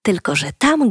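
plain reading voice

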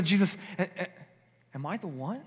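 a disciple afraid it is him; fearful plea. Acted. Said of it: "looks to Jesus and says".

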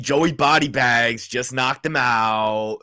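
slow, stretched Philly voice; Philly accent. Pretend. Co-host repeating the fed line on request, Philly accent. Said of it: "Say that".

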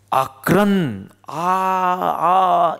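high, loud drawn-out lament; demonstrated wail. Shown as a demonstration. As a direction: draw out an "aaah" like the wails of hell. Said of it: "એવી રીતે નરકમાં આ જ સંભળાય".

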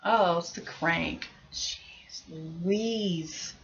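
ordinary talk

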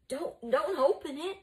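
high, loud, frightened plea; choked warning. A narrator's reading. Flavius warns Mucius off the wardrobe, choked. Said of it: "warned in a choked voice".

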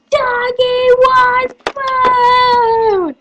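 high, slow, sung-out call; drawn-out catchphrase. Acted. Calling out Doggy One Boom, high and long drawn out. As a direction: stretch each word out high.

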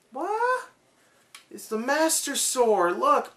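high, amazed gasp of discovery; surprised. Pretend. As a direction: act stunned at finding it. Mock surprise finding the sword.

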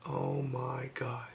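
breathless whispered awe; a stunned whisper to himself. Real, not acted. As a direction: barely breathe the words out. Overwhelmed, alone at night.

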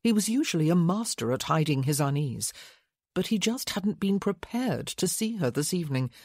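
ordinary delivery

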